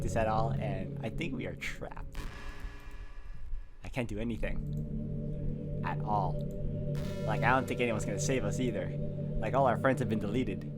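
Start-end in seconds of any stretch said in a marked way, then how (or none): none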